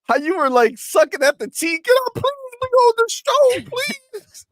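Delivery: shrill pleading mimicry; mocking high voice. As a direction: beg in a high squeaky whine. Mimicking the begging guest.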